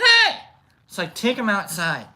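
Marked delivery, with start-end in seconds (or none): 0.0-0.4 very high, loud squeaky yelp; squeaky mouse. Retold or imitated. Mouse yelping high and loud at the man. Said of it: "And like".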